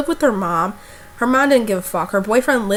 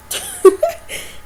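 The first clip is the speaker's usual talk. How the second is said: high laugh; 3 dB louder than usual. Real, not acted. Laugh about the older guy.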